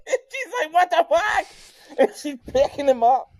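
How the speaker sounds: can't stop laughing while telling; giggling story; laughing hard. Real, not acted. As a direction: talk through high laughter.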